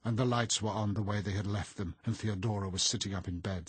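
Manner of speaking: plain reading voice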